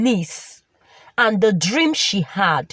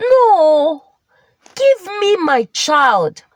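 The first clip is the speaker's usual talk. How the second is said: insistent demand; 5 semitones higher, 3 dB louder, slower than usual. Acted. Ebun demands her child from the angel, insistent. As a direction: protest it higher and louder at first.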